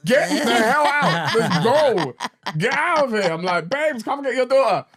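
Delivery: high, loud, laughing yell from the shower; laughing shout. Retold or imitated. Dad yelling for his wife to fetch the daughter, laughing. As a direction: shout for help, half laughing. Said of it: "And I'm like".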